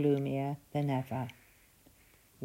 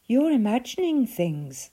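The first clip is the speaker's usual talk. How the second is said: higher, louder brisk wife; giantess dismissing; 8 semitones higher, 8 dB louder than usual. A narrator's reading. Wife dismissing the giant's suspicions, higher and brisk. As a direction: brush him off. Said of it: "said his wife".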